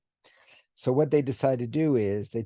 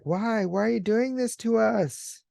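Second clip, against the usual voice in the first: much higher, anguished cry of the victims; pleading humans; 12 semitones higher than usual. Retold or imitated. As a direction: plead with the attackers. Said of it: "the humans are like".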